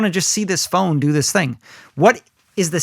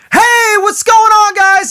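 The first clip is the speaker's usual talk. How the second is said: much higher, louder, slow hyped greeting; excited show opener; 7 dB louder, slower than usual. Retold or imitated. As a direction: fire up the crowd from the stage. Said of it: "I'm excited".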